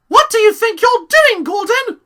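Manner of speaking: very high, loud scolding mock voice; hammed-up scolding parent; stern mother voice. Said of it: "It's like".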